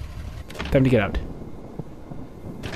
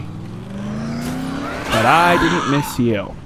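slow taunt; 2 semitones lower, much slower than usual. Pretend. Player gloating slowly at enemies he just hit. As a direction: savour every word of the taunt.

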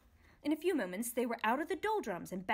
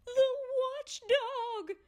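much higher, louder panicked cry; frightened shout; 4 dB louder, much slower than usual. Acted. Lethargarian warning the others, frightened shout. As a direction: yell it in terror. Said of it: "shouted another, fainting from fright".

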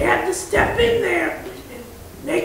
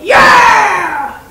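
loud cheering yell; gleeful shout; 3 semitones higher, 10 dB louder than usual. Acted. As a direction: cheer it loudly at the news. Student cheers at suspension.

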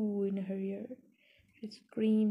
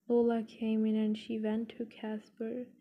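usual speaking voice

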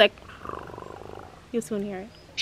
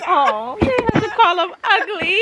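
laughing, higher, mock-offended; laughing protest; 8 semitones higher than usual. Real, not acted. Defending the dog while laughing. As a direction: giggle through the protest.

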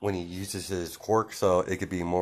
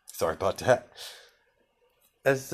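plain reading voice

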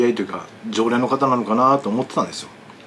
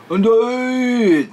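high, very slow, drawn-out rough call; rough old man's greeting; 12 semitones higher, 6 dB louder, much slower than usual. Retold or imitated. Imitating the old man's slurred greeting in the barbershop. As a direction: stretch it out like a brash old regular. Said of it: "もっとおにやったじゃんね".

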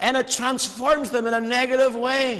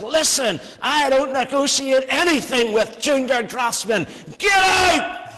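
retold furious shout at junior staff, rising higher and louder to a high yell on "Get out!"; screaming boss; 4 semitones higher, 3 dB louder than usual. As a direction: yell it like an arrogant boss throwing them out. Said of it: "he began to scream at us".

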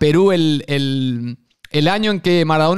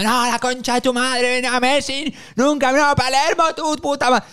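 high, strained Argentine rant, much higher; raging Argentine fan; 9 semitones higher, slower than usual. Retold or imitated. Angry fan cursing the national team, raging Argentine accent. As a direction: rant like a furious porteño fan in the stands. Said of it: "todo el rato rabiando".

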